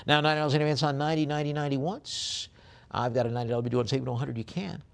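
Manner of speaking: quick, lifted bid-calling, drawing out the going calls; auctioneer's call. Acted.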